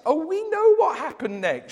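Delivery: put-on high, knowing old lady. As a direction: tell him like an old lady who already knows. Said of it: "she said".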